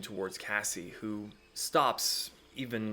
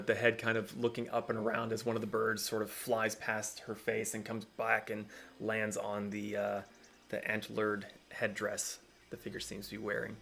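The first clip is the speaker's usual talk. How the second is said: ordinary talk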